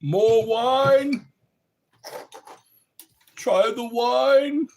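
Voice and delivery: high, loud, slow hammy offer of wine; mock film villain pressing wine; Clockwork Orange quote. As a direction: push the wine on your guest, slow and loud. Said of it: "my favorite parts of A Clockwork Orange".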